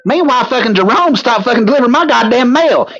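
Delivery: a put-on high voice complaining to Shane; character voice. Character complains about mail.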